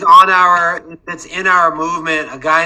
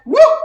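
whoop; 4 dB louder than usual. Retold or imitated. Lights going off, whoop.

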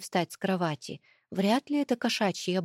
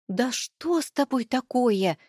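hissing; 4 semitones higher than usual. Acted. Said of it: "зашипела я".

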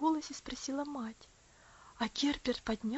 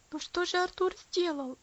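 pleading; 6 semitones higher than usual. A narrator's reading. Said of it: "взмолился Герберт".

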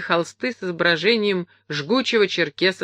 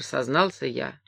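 usual speaking voice

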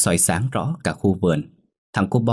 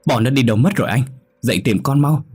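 urgent plea; 6 semitones higher, 4 dB louder, faster than usual. Acted. Wife urgently waking husband.

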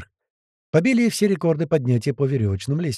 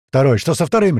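loud, higher roared question; roaring; 4 semitones higher, 7 dB louder than usual. Acted. Tuman demands news of second boat, roaring. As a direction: roar for a status report. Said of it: "ревел Туман".

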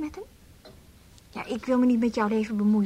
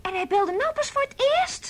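incredulous; 12 semitones higher than usual. Acted. Incredulous at month-long silence.

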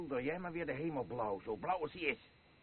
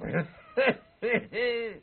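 higher, louder nasty laugh; drunken mocking laugh at his wife; cruel cackle; 9 semitones higher, 8 dB louder than usual. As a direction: cackle at your own insult.

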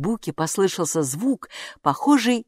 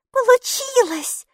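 breathed out relief; 4 dB louder, slower than usual. A narrator's reading. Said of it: "выдохнула Майки".

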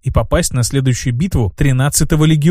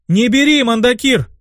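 high-pitched warning cry; acted high cry of warning; priestess crying out; 9 semitones higher than usual. A narrator's reading. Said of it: "крикнула одна из верховных жриц".

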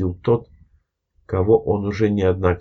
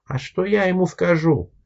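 higher, lost question; bewildered; 11 semitones higher than usual. Acted. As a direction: ask helplessly, at a loss. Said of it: "растерянно спросил Виталий".

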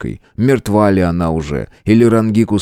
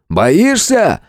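high, goading jeer; taunting; 9 semitones higher than usual. Acted. Fighter taunts the halted monster, goading. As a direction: goad the enemy with a high call.

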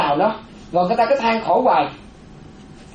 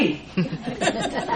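soft laugh; 8 dB quieter than usual. Real, not acted. Monk laughs mid-quote.

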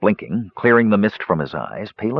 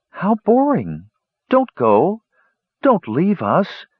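high, put-on female voices; high-pitched disappointed pleading; pleading women; 11 semitones higher, slower than usual. A narrator's reading. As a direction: beg them to stay in a high whine. Women pleading with departing brothers.

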